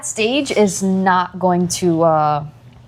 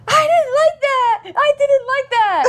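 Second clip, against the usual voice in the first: wobbly mock-crying child voice, pitched up; whiny crying child. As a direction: imitate a hurt toddler about to cry. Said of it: "she was like".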